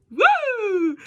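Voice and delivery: loud, high-pitched squeal; high whoop. Real, not acted. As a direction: let out a piercing playful whoop. Playful squeal after high-voice remark.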